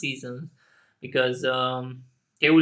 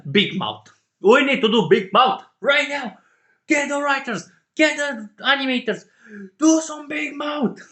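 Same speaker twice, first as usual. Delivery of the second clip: much higher and louder demands; demanding fan shout; 11 semitones higher, 7 dB louder than usual. Real, not acted. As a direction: order the studio around, fired up. Fan demanding Netflix act.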